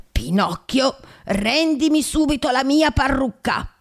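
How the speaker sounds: put-on high, slow old man ordering; a flustered old carpenter scolding; indignant demand. A narrator's reading. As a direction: insist he give back the wig. Geppetto demands wig back.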